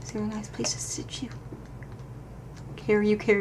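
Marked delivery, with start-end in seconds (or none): 0.0-1.3 soft whisper to a toy. Acted. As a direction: whisper tenderly to the bear. Saying goodbye to toy bear.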